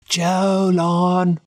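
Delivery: high call across a cavern. A narrator's reading. Boy calls out to his lost friend, a high call. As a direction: call his name into the dark. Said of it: "and called out".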